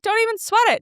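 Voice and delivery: high, airy don't-worry voice; breezy reassurance. Retold or imitated. Scene: reassuring the upset restaurant girl, airy and high. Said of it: "the way I'm saying it".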